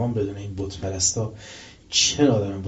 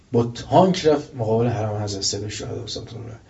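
ordinary delivery

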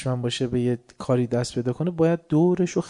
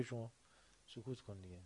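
سکوت کن دیگه dropped to a near-silent murmur; hushed aside; 3 semitones lower, 28 dB quieter than usual. Retold or imitated. Preacher quietly tells complainer to hush. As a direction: let سکوت fall away almost to nothing.

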